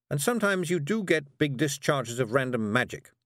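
high, hurried witch voice; flustered witch. Acted. Miss Tick explains.

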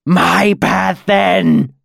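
high, rasping demon shriek. A narrator's reading. Chained demon defying his captor, shrieking. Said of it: "Incanus shrieked".

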